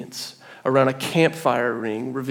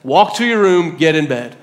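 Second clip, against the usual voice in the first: stern father; 3 semitones higher than usual. Retold or imitated. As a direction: lay down the law to a child. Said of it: "I had to get so stern with him".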